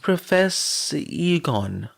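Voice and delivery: put-on high, slow little-girl call; child calling out. A narrator's reading. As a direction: call out like a small girl wanting attention. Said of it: "Eri called out".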